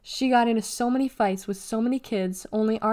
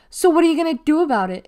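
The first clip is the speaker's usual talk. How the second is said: high, loud, fast challenge; quick, loud taunt pitched up; sneer; 7 semitones higher, 9 dB louder, much faster than usual. A narrator's reading. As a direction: goad with a sneer. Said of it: "it sneered".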